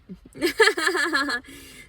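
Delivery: high, loud burst of real laughter. Real, not acted. Laughing at his own messy sudoku boards, a loud burst of laughter.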